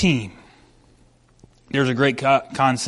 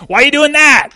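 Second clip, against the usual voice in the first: sharp, high, loud mock of his wife; hammed-up confrontational question; imagined accusing tone; 11 semitones higher, 9 dB louder than usual. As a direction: exaggerate a wife's loud, accusing demand. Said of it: "the way I heard it was kind of a".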